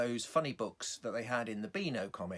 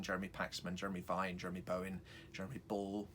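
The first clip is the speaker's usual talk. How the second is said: normal talking voice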